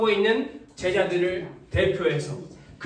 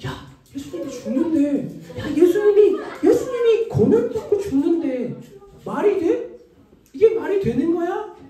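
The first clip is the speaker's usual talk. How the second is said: higher-pitched, incredulous whispers-turned-chatter; disciples murmuring in disbelief; 5 semitones higher than usual. Acted. Disciples to each other about Jesus dying, in higher-pitched disbelief. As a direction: act out stunned disciples questioning each other. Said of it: "서로서로 술렁술렁하며".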